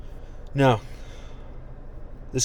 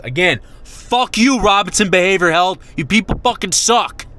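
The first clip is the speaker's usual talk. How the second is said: furious curse; 6 semitones higher, 5 dB louder than usual. Real, not acted. Cursing out the behavioral health office in fury.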